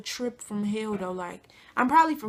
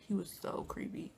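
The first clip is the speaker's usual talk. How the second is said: whisper; 3 semitones lower, 16 dB quieter than usual. Real, not acted. Gossiping about creepy singer.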